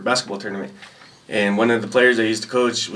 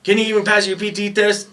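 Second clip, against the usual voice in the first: drill sergeant voice; 10 semitones higher than usual. Retold or imitated. Other battery's drill sergeant questioning a recruit, high mock bark. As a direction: grill him like a drill sergeant.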